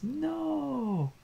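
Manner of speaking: very high, drawn-out no; wailed denial. A narrator's reading. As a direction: wail it in comic protest. Hero repeats his horrified denial.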